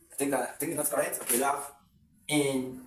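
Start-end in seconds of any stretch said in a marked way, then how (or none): 2.3-2.8 encouragement in four separate pieces with pauses; syllable by syllable. Shown as a demonstration. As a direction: sound out "encouragement" one syllable at a time. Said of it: "called encouragement".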